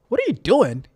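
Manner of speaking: pestering inner voice; annoying voice. Acted. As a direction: nag them like an annoying conscience. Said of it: "my annoying voice comes saying".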